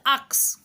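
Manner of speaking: "ask" said as aks with the s and k swapped; mispronounced ask. Shown as a demonstration. Teacher showing the mistake, "ask" said as aks. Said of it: "she uttered the word instead of ask".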